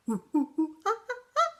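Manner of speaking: high monkey hoots and chatter; playful "ooh-ooh, ah-ah" monkey call; monkey talk. Shown as a demonstration. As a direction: demonstrate monkey noises. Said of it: "do your very best monkey talk".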